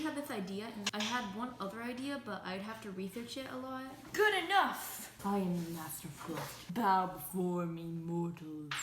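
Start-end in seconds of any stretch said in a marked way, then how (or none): 4.1-5.1 triumphant shout. Real, not acted. Triumphant cake craft moment. 5.2-8.7 a hint of a gloating dark lord; lower, slow mock villain command. Gloating over finished cake.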